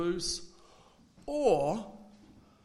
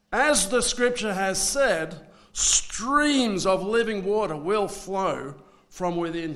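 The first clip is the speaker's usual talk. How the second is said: full-throated proclamation, much louder, higher and slow; 4 semitones higher, 12 dB louder than usual. Shown as a demonstration. Jesus calls out to the festival crowd, booming slow shout. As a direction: proclaim it to the whole temple at full volume. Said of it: "I'm gonna say this in a loud voice".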